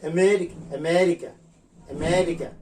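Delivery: আমেরিকা said slowly, lips spread on the এ; teacher's example word for the vowel; example pronunciation. Shown as a demonstration. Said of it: "যেমন বলা হয়".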